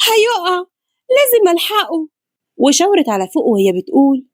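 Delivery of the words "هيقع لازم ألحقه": very high, alarmed voice; urgent, high-pitched dread; panicked cry. A narrator's reading. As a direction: cry out as if someone is about to fall. Panicked girl sees father falling.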